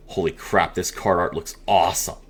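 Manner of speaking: nearly whispered amazement; hushed awe. Real, not acted. Presenter to viewers, stunned by the card art, hushed awe.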